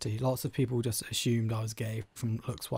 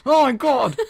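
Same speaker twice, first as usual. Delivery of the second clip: laughing exclamation; 11 semitones higher, 9 dB louder, slower than usual. Real, not acted. Bursts out laughing mid-plug.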